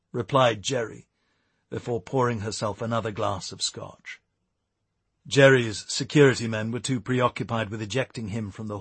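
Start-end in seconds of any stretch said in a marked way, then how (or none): none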